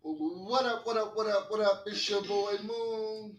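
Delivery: energetic podcast host greeting the audience; hype greeting. Acted. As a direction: hype up the listeners like a radio host. Podcast host hyping the show open.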